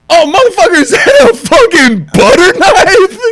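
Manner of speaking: high, loud, laugh-cracked shout; mocking a butter knife in loud laughter; laughing disbelief. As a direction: cry out through laughter. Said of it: "and I'm like".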